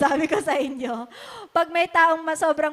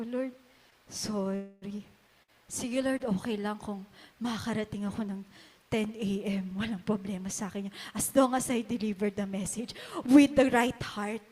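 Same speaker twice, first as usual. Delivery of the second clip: humble soft prayer; 6 semitones lower, 5 dB quieter than usual. Retold or imitated.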